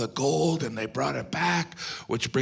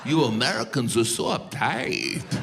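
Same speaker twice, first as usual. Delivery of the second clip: Spanish-accented teasing, lightly put on; mock Spanish accent; 3 semitones lower than usual. Mimics Spaniard mocking Americans.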